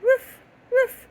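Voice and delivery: high, loud barking woof; dog bark. Shown as a demonstration. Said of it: "It's a dog".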